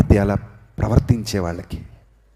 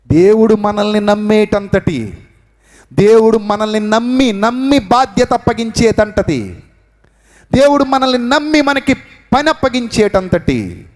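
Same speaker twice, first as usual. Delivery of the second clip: high, rising pulpit fervour; preacher challenging the church, rising and fervent; impassioned preaching; 10 semitones higher, 3 dB louder, slower than usual. Acted. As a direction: build the appeal line by line. Preaching builds.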